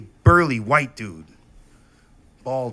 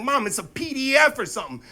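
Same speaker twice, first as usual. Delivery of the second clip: pleading son; 12 semitones higher than usual. Retold or imitated.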